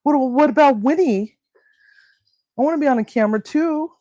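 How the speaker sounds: high, quick pet voice for the cat; voicing the cat. Voicing Winston the cat wanting camera time, high quick plea. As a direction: speak for Winston, a bit whiny.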